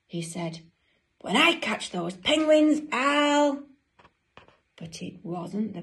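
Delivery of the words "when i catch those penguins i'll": loud, high, rising threat; furious penguin threat. Acted. Dudley hearing bangs at the door, loud angry threat. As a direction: build loudly and leave it hanging.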